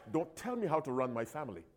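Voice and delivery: quiet and fast, a stubborn muttered condition; grudging quick aside. Retold or imitated. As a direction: rush the catch out softly. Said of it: "Here's how some people surrender themselves".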